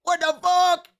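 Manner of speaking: put-on very high, loud shriek of a kid; shocked kid. Kid seeing parent's Warcraft gear, very high shocked shriek. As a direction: squeal it in disbelief. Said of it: "He's gonna be like".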